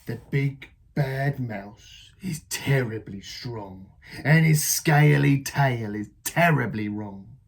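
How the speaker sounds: put-on scare the child with the tale; spooky Gruffalo dad. Dad describes the scary mouse.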